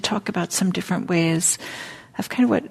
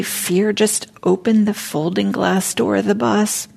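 poem told with playful drama; poem reading; 2 semitones higher than usual. Acted. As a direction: read the poem as a little performance. Reads a poem aloud in a talk.

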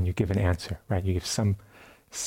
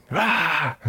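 sudden mock yell, higher and a bit louder; retold scream; 6 semitones higher, 3 dB louder than usual. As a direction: blurt one sharp cry as if bursting in. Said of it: "So he would go in and scream".

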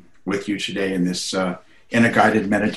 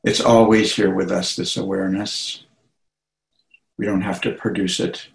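unhurried meditation guidance with long pauses; guided meditation. Acted. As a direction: guide listeners gently into relaxation, phrase by phrase.

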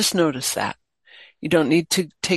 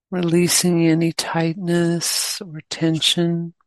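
guided meditation. Real, not acted. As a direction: guide them gently, leaving long pauses between phrases.

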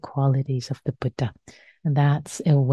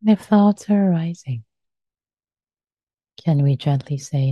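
guided meditation. Acted. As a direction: guide them gently into stillness.

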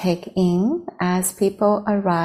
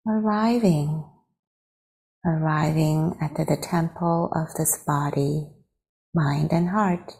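guided meditation. Real, not acted. Teacher leading friends to arrive in the body, guided meditation. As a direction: guide them unhurriedly into stillness.

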